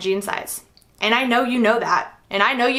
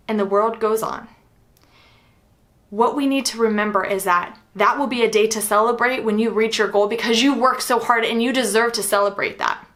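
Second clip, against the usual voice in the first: normal talking voice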